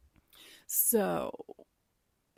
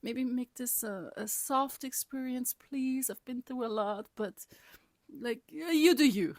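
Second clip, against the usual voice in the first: higher, lightly sweet-voiced plea to the medicine; a hint of a childlike prayer to Mama Aya; pleading prayer; 6 semitones higher, 3 dB louder than usual. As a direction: coax the spirit like a bargaining child. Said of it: "my intention was like".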